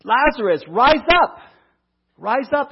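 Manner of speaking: high, loud summons to rise; loud command. Acted. Preacher voicing Jesus calling Lazarus, a loud high summons. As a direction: call it out like raising the dead. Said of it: "these words that I envision Jesus saying".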